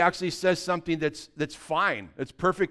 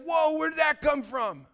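high-pitched mock shock; acted alarm at a sudden thought; startled outcry; 10 semitones higher than usual. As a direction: cry out high, taken aback. Acts shock at intrusive thought.

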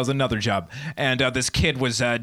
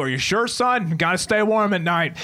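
fussing dad; 7 semitones higher than usual. Acted. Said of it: "Dad pops up too".

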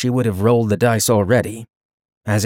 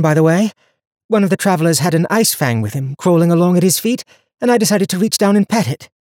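lightly voiced young heroine, higher; young woman voice; 8 semitones higher, 4 dB louder than usual. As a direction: speak her lines higher and brighter. Donia recounts her past.

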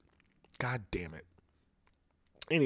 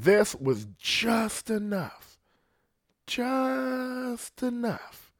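drawn-out dramatic emphasis; 11 semitones higher, 3 dB quieter, much slower than usual. Acted. Dramatic buildup to Larry snapping.

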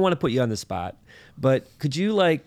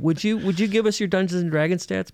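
mock interviewer; 8 semitones higher than usual. Playful interviewer voice.

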